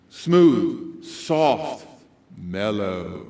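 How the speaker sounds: low, smooth, mellow delivery; late-night DJ. Shown as a demonstration. Speaker describes night DJ to audience, smooth and mellow. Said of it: "What does the very late night D J sound like?".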